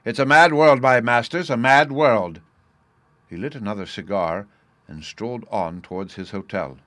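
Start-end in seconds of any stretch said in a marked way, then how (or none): 0.0-2.4 loud, raised-pitch declamation; wry exclamation. Acted. Officer left alone after the odd meeting, exclaiming. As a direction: declaim it to yourself, loud on each phrase.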